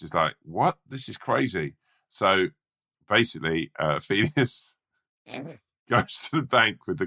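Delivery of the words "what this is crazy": high, rising "what?" of mock amazement; acted disbelief. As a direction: play a rat who can't believe it. Felius learns it is gold.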